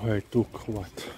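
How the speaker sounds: usual speaking voice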